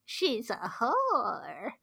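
slow, laughing mock mother; hammed-up mother voice, drawn out; mother's voice. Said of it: "the voice makes me laugh".

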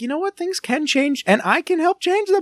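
high-pitched, acted burst of hope; mock-earnest, high, newly empowered; inspired resolve. Voices a newly inspired viewer's thought.

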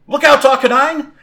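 loud, high cry of alarm; shouted warning. Acted. Trainer warns Arcanine of the leap, shouting. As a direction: warn your Pokemon urgently.

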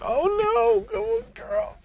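high, cracking voice, imitating tears; mock crying. Host imitating himself crying at the goodbye scene, high and teary. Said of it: "I'm tearing up".

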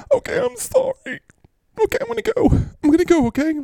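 very high, whimpering apology; tearful. Acted. Apologising before leaving, in high mock tears. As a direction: whimper as you excuse yourself to go. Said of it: "if you cry".

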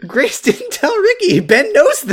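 high, slow, emphatic; exasperated insistence. Real, not acted. Arguing the plot point to co-host, high and exasperated.

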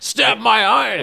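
very high, loud, stretched-out mock shout; shouted catchphrase. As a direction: belt it out as a hero's catchphrase. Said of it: "It's one of his catchphrases".

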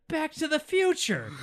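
slow, high mock shout; triumphant exclamation. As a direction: proclaim it loudly, stretched out and hammed-up. Acts out Doc's famous exclamation.

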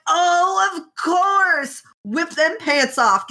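high, mock eager cheer; gleeful Wei Wuxian. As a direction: sell the idea with cheeky glee. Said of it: "Wei Wuxian's like".

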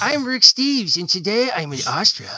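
high, loud goofy travel host; mock a dorky TV presenter introducing himself; exaggerated, high-pitched host impression; Rick Steves. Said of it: "sounds like a guy who talks like this".

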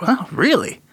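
a touch of awe; impressed. Acted. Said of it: "he's like".

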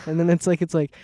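normal talking voice

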